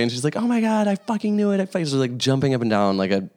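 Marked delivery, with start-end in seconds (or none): 0.4-1.6 high-pitched, thrilled outburst; mock-squealed delight, pitched way up; excited squeal. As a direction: retell her jumping-up-and-down excitement. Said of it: "just like, so excited".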